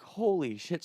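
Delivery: gasp-like high disbelief, kept contained; shocked exclamation. Retold or imitated. Friends discover their bound buddy, retold in shock.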